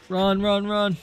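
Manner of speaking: high frantic run run run; urgent shout. Real, not acted. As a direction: yell them toward safety. Urging teammate to escape.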